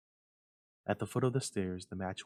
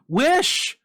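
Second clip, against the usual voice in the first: high, loud insistent demand; commanding shout; 17 dB louder than usual. A narrator's reading. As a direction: force him to wish. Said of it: "repeated his wife".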